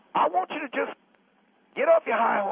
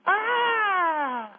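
mock scream; 8 semitones higher than usual. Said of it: "this is what you sounded like".

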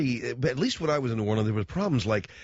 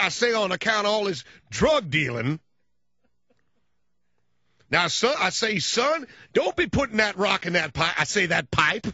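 Southern cartoon rooster blowhard lecturing the young DJ; Foghorn Leghorn; 9 semitones higher, 3 dB louder than usual. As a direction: boom it out like a cartoon rooster colonel. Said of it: "he talked like Foghorn Leghorn".

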